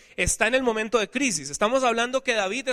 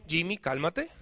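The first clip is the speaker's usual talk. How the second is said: calm himself down softly under his breath; a father softly talking himself down in the store, hushed; 4 semitones lower, 9 dB quieter than usual. Retold or imitated. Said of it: "él le decía al niño".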